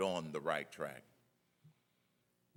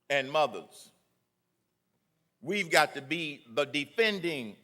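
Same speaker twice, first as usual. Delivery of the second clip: rally oratory; 4 semitones higher, 6 dB louder than usual. Acted. Speaker urging the rally to defend the Declaration, emphatic oratory. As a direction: build the crowd up line by line. Rally speech building again.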